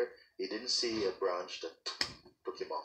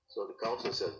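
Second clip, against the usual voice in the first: plain reading voice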